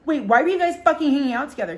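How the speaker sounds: high, loud, quick accusation; retold rush of indignation; mock indignant friend. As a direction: imitate an outraged friend demanding answers. Friend confronts them in retold quarrel.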